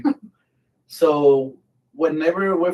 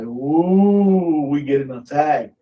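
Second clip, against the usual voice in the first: drawn-out rising "ooh", teasing; mock alarm; 4 dB louder, much slower than usual. Comrade at the card table announcing an attack, mock alarm. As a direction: imitate a buddy crying attack. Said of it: "one of the guys is like".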